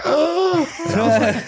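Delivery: sharp high gasp, slowed; mock startled gasp. Imitating the other driver's reaction.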